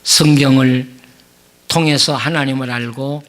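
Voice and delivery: earnest prayer with long pauses between phrases; closing prayer. Acted. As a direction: pray humbly before the congregation.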